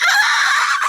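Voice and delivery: high squeal of delight; mock kids' shriek; kids squealing. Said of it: "the kids are like".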